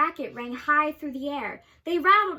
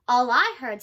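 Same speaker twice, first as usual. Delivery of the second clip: a louder, lower curt snap; snapping; 3 semitones lower, 7 dB louder than usual. A narrator's reading. Kangaroo brushing off the elephant, snapping loud and low. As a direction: snap it back dismissively. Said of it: "snapped the big kangaroo".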